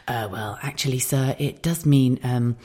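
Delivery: hushed, halting polite explanation; discreet, embarrassed. Retold or imitated. Waitress explaining a rude phrase to a customer, discreet hush.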